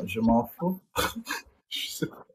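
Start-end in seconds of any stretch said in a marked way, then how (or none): none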